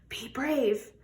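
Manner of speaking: usual speaking voice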